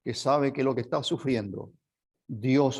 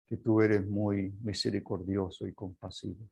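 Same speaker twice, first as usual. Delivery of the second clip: prayer; 2 semitones lower, 3 dB quieter than usual. Acted. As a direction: pray humbly over the suffering. Leads the closing prayer. (oración pausada y suave)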